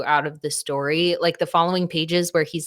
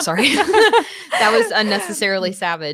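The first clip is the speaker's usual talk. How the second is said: laughing; 4 dB louder, faster than usual. Real, not acted. Laughing at own jab.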